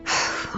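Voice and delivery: soft, voiceless sigh; exasperated sigh. Acted. Shilonen to Auntie, who has beaten around the bush, exasperated sigh. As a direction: sigh before a gentle reproach.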